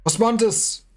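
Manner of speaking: high-pitched urgent call. Acted. Soldier calling to comrade Osmondes, high urgent call.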